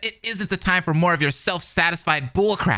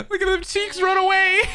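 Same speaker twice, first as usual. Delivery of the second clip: laughing; 12 semitones higher than usual. Real, not acted. Mocking high laughing.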